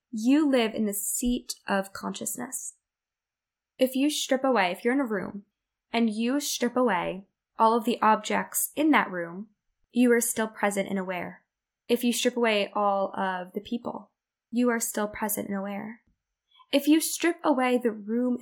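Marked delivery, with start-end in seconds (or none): none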